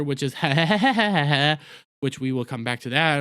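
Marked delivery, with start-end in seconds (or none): 0.4-1.6 imitated villain laugh, breathy and drawn out; Toymaker's laughter. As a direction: mimic the creepy toymaker's cackle. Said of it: "the Celestial Toymaker's laughter".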